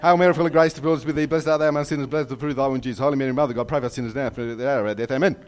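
rapid rote gabble, sinking in pitch; rattled-off prayer. Retold or imitated. Boy rushing penance to get back to his seat, rattled off. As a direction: race through it without a breath to Amen. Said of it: "reciting those as fast as I could".

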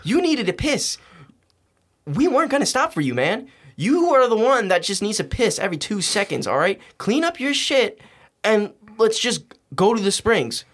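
exasperated retort. Retold or imitated. Retold argument over the mess.

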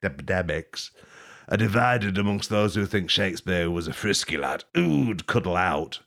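usual speaking voice

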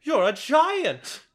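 high-pitched, flabbergasted onlooker; mock astonishment. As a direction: gasp it out in hammed-up disbelief. Acting astonished onlooker to the giant.

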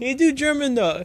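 put-on high-pitched botched German accent; failed German accent. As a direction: badly fake a German's broken English. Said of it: "that's not a German accent".

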